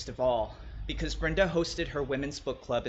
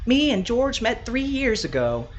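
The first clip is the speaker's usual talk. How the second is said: higher, slower chatty woman; higher-pitched voice of Brenda telling her favourite story; Brenda's voice; 8 semitones higher, 4 dB louder than usual. Acted. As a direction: play Brenda, a fond gossipy host.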